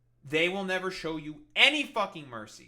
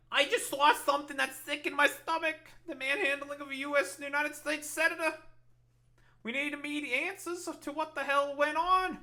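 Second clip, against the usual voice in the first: pitched way up, mocking Schumer's lines back at him; mocking Schumer impression; 9 semitones higher than usual. Streamer mocking the senator's speech in a high, whiny voice. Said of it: "That's it? That's all you gotta say?".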